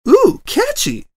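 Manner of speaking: high delighted squeal; a bubbly high "ooh"; excited squeal. A narrator's reading. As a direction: squeal it with glee.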